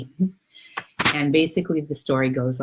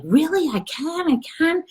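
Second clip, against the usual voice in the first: put-on very high, childlike disbelief; excited little soul. Little soul hears God say yes, thrilled and high-pitched. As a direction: squeal it like an amazed little soul. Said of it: "he's like".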